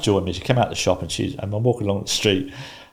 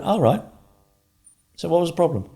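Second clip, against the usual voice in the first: much higher, airy innocent question; mock innocent; 10 semitones higher than usual. Calmly asking the ranting caller what's wrong, mock innocent. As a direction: play dumb about the problem.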